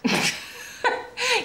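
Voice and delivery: a short high burst of laughter; amused laugh. Real, not acted. Patient laughing at her fussing visitor, amused laugh. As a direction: laugh him off kindly.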